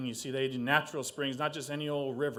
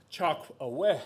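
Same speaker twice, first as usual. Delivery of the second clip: bigger opener; 2 semitones higher, 5 dB louder than usual. Shown as a demonstration. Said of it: "I'll try that one more time".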